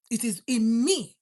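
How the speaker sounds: slow booming sermon line; emphatic declaration. Real, not acted. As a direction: land it hard on the congregation.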